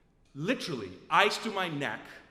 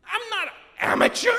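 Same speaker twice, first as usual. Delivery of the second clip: high, loud, quick protest; mock outraged outburst; indignant retort; 11 semitones higher, 9 dB louder, faster than usual. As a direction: snap back in wounded pride.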